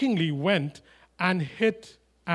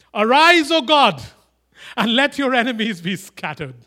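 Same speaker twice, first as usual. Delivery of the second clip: loud, high proclamation; ringing, forceful declamation; preaching at full force; 7 semitones higher, 10 dB louder than usual. Acted. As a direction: cry out the prayer to God. Declaims Moses' prayer.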